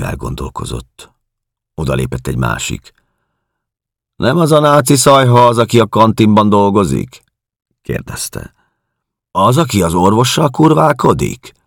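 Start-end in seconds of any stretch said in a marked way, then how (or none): 4.2-7.2 high, loud, sustained sneering tone; contemptuous question. A narrator's reading. Second prisoner asking about the canteen woman, contemptuous. 9.3-11.5 high, loud gossiping scorn; sneering question. A narrator's reading. Prisoner asking about the doctor's lover, sneering.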